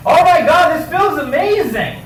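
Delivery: very high-pitched put-on bliss; mock ecstatic praise. Comic acting out praise during sex, mock ecstatic. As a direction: gush it in an exaggerated high voice. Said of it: "I wanted to say something nice".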